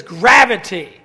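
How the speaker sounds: a deadpan zinger, hit loud; punchline. Retold or imitated. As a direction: land the joke word hard. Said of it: "they just all burst out laughing".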